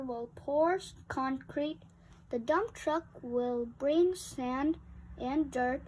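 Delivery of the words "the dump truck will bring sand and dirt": plain reading voice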